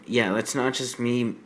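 ordinary talk, nothing marked

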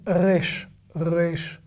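Resh à l'israélienne, posé et plus doux; accent israélien. Shown as a demonstration. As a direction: dire Resh avec l'accent israélien, R non roulé. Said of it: "c'est avec l'accent israélien". (accent israélien, R de gorge)

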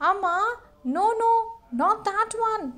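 high, loud protest; alarmed boy. Acted. Anil stopping Amma at the puppy pile, alarmed. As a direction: rush to stop mother. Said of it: "said Anil".

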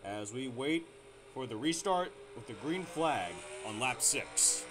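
ordinary talk

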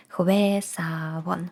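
Хвесавон very slow and soft; slow read. Shown as a demonstration. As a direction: spell out Хвесавон slowly. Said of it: "word demo".

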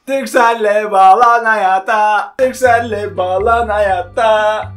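slow, announcer-like slogan read; sing-song it like a commercial. Pretend. Quoting the ad slogan.

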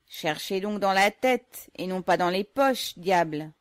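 loud, slow taunting shout at the guard. Acted. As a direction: yell it back over the search. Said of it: "criait au gardien".

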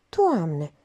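ordinary delivery, nothing marked

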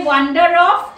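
"Wander off" said loud and clear for the class to repeat; model phrase for repeating. Shown as a demonstration.

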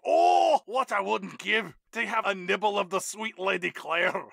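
hammed-up Scottish longing, opening on a high loud moan; Gerard Butler's Scottish accent. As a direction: swoon in a mock Scottish accent. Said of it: "Legitimate Scottish accents happening".

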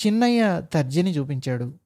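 plain reading voice

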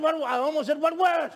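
high, loud incredulous comeback; shouted retort. Retold or imitated. Preacher answering the big man's demand, shouting back. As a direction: fire back a shouted question. Preacher acts his startled reply.

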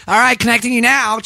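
high-pitched switchboard operator voice; old-time telephone operator. Acted. Host playing a forties operator, switchboard voice. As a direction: put on a high, formal operator call. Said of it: "The operators with the".

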